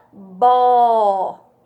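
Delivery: "bó" held long and loud; sílaba isolada. Shown as a demonstration. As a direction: sound out "bó" as one stretched syllable. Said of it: "que forma". (sílaba "bó" alongada e forte)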